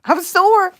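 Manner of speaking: high, loud laughing cry of sore. Real, not acted. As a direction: squeal it through a laugh. Laughing repeat of "I'm sore.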